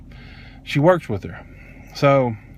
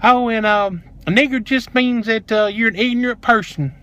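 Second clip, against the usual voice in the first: high, singsong mock explanation; mocking imitation; 6 semitones higher than usual. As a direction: parrot her excuse up high. Mimics the clerk's excuses.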